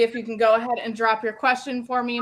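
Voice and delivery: normal talking voice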